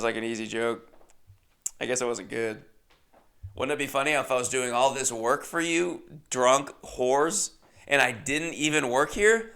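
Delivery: normal talking voice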